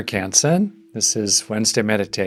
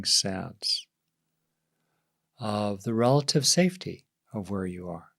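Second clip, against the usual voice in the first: guided meditation. Real, not acted. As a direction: ease them gently into settling, leaving space between phrases.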